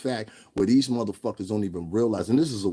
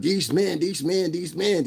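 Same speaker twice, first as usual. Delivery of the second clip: mocking women; 8 semitones higher, slower than usual. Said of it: "Bitches is doing nine-hour motherfucking lives talking about".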